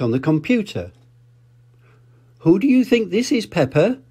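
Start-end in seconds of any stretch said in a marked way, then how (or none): 2.4-4.0 higher playful quiz voice; Mummy Pig asking. Acted. Mummy Pig quizzing Peppa about the photo, higher. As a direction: tease the child with a question. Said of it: "Mummy Pig asked".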